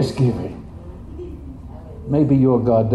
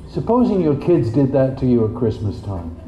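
ordinary talk

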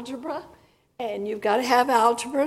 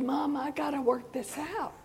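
hushed, unvoiced retelling; soft murmured reply; quiet plea; 2 semitones higher, 6 dB quieter than usual. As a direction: quietly tell mom you'll handle it. Quietly answering her mother.

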